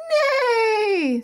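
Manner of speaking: high, whinnying neigh; horse neigh. Shown as a demonstration. Teacher neighs like a horse for kids.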